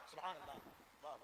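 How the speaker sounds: hushed praise. Real, not acted. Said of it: "soft exclamation after slogans".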